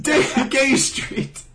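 slow, drawn-out deadpan reveal; drawn-out punchline. Retold or imitated. Brother's prank answer savored slowly. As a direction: stretch it out like a punchline.

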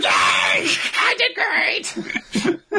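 put-on high, squealing happy little kid; Phil. Little kid told good job by Mom, gleeful gremlin squeal. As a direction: shriek it like an overjoyed tiny tyke, laughing. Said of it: "little gremlin with a happy face".

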